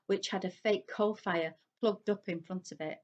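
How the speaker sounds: ordinary delivery, nothing marked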